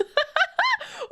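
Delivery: squeaky delighted laugh; high giggle. Real, not acted. Streamer laughing.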